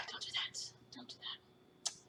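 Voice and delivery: breathy whispered warning. Real, not acted. Whispered aside to child.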